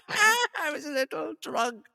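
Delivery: very high, slow tipsy English voice; Dudley Moore. Acted. After a weekend learning the voice, drunken impersonation. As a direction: play the loud, giggly comic drunk. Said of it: "You gotta learn Dudley Moore's voice".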